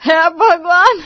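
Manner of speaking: ordinary talk, nothing marked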